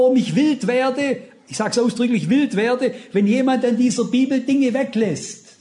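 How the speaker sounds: impassioned pulpit cry of shame and zeal; fiery preaching. Acted. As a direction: preach it with rising fervour, pressing the crowd. Preaching at full force.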